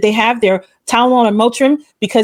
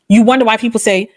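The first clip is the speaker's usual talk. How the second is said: ordinary talk, nothing marked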